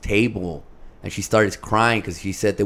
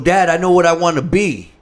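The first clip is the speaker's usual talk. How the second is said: louder, higher, eager announcement; fired up; 5 semitones higher, 6 dB louder than usual. Retold or imitated. Son announcing a career to his dad, fired up. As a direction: play the son all fired up. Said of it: "'cause he was all fired up".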